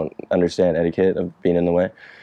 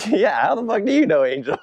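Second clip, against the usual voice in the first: higher, laughing disbelief; retold stunned reply, pitched up; amazed disbelief; 10 semitones higher than usual. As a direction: act baffled that a stranger knows him.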